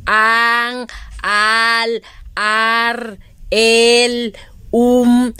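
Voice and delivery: an, al, ar, el, um each called out loud; syllables called out. Shown as a demonstration. Teacher reading syllables to children, loud and clear. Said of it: "Por ejemplo".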